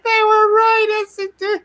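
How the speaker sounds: wailing Cartman. Retold or imitated. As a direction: cry it out in horrified realization. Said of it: "And it's just like".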